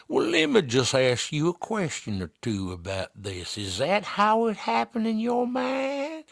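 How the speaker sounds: slow, high Southern lawyer drawl, pitch rising. Retold or imitated. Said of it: "when the Southerners get involved".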